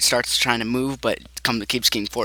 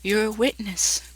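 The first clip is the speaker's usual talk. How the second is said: airy high-pitched warning; high eerie figure; 10 semitones higher, slower than usual. Acted. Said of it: "She says".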